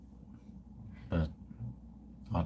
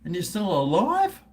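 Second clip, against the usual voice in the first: mock surprise; 8 semitones higher than usual. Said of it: "I said".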